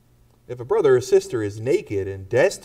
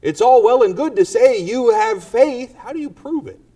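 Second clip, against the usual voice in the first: skeptic's challenge; 11 semitones higher, 9 dB louder than usual. Acted. Challenger voiced in sermon.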